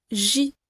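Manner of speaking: J with a falling tune; falling demo J. Shown as a demonstration. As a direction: let the J drop at the end. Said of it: "l'intonation va être aussi descendante".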